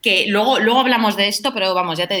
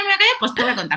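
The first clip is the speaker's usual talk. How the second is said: ordinary talk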